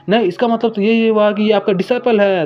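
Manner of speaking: high, stunned reply; startled realization. Acted. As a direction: catch on with a jolt of surprise. Disciple realizes with shock.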